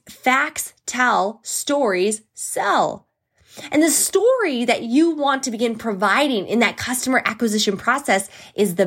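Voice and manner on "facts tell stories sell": slow emphatic slogan. Real, not acted. Slogan delivered slowly for emphasis.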